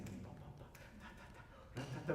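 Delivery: hushed, nearly whispered orchestra vamp; listening accompaniment. Shown as a demonstration. Coach shows orchestra listening to the singer, hushed. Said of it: "I'm listening, I'm listening".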